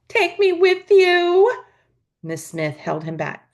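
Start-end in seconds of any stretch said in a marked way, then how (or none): 0.1-1.6 high, slow, wailing plea; howling child. A narrator's reading. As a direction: wail it like a desperate little boy. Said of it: "Jamie began to howl".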